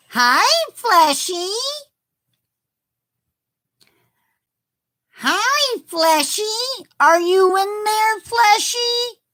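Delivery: high, loud squeaky calling; character voice. Shown as a demonstration. Streamer calling out in the character's high voice. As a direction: call out in a high cartoon creature squeak. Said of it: "my voice is a little sore too".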